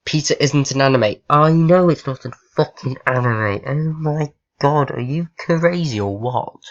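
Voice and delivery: loud annoyed rant. Real, not acted. Gamer scolding chatbot for nonsense reply, loud rant. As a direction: tell the bot off, loud.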